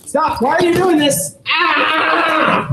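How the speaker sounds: high pained cries. Real, not acted. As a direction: cry out in real pain. Man in pain during arrest.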